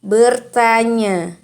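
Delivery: ordinary delivery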